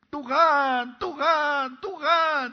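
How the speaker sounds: high, loud, sobbing call of Tuhan; crying out to God. Acted. Acting a believer calling God, sobbing high cry. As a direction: cry the name like a desperate prayer.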